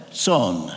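Son said like "tsonn", low and short; Romanian pronunciation. Shown as a demonstration. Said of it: "in Romanian pronounced T S O N".

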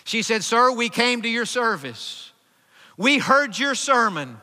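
high, loud pulpit testimony; impassioned preaching. Retold or imitated. Preacher retelling a girl's testimony of being saved, impassioned. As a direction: declare the retold testimony with full conviction.

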